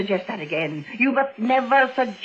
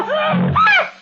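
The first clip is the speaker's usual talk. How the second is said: piercing high scream, loud; terrified scream; 6 dB louder than usual. Acted. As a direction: scream as the door opens. Terror peaks, she screams.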